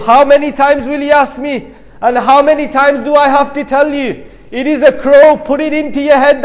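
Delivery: angry. Retold or imitated. Grown son scolds his father for asking repeatedly, angry. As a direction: raise the pitch in mounting irritation, acting out a fed-up son. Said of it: "becomes angry and he says".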